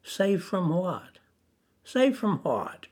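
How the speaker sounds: lightly voiced doubter asking back; puzzled unbeliever. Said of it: "they would probably say back to you".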